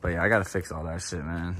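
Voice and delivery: low-energy flat delivery, worn out; tired monotone. Real, not acted. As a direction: drag through it like you're exhausted. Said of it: "sorry for the monotone voice".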